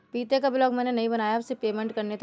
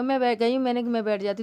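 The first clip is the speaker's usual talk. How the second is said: ordinary talk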